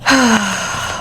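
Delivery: a long, heavy sigh of emotion. Real, not acted. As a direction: let out a real sigh, moved. Emotional reading, sighs.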